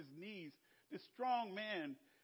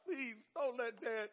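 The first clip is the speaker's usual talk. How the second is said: drawn-out, slightly higher plea; pleading; 5 semitones higher, slower than usual. Retold or imitated. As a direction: plead slowly, as a child in trouble would. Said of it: "I pleaded with God".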